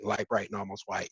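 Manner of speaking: ordinary delivery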